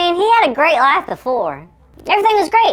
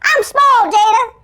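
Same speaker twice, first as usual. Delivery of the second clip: squeaky, much higher and louder shouting; cartoon elf voice; 10 semitones higher, 3 dB louder than usual. Shrunken [name] shouting up to Jada, squeaky elf voice. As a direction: yell up at her like a tiny elf. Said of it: "sound like a cartoon elf".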